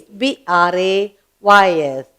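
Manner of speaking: ordinary delivery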